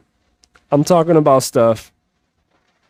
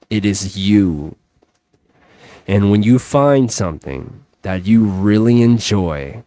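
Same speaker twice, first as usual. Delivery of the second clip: low, hushed reflective guide voice; calm inner-work guidance; guided reflection; 5 semitones lower than usual. Acted. Leads a quiet reflection.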